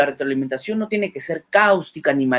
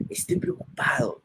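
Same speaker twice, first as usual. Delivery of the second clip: higher, softer, truly worried tone; 13 semitones higher, 9 dB quieter than usual. Shown as a demonstration. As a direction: let the worry show in the voice. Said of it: "y colocas una cara de preocupado". (con voz preocupada, más aguda y suave)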